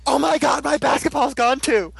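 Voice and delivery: high-pitched mock panicked outcry. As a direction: wail it in exaggerated alarm. Retold character panics over missing basketball.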